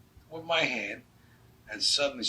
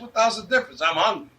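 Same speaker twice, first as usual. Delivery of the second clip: loud, high, impatient comeback; exasperated retort; 8 semitones higher, 9 dB louder, faster than usual. Retold or imitated. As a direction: argue back loudly, hungry and fed up. Retold argument about eating fried chicken.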